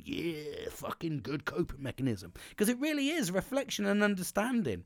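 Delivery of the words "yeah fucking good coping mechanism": quiet mock cheer. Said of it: "with my fist in the air going".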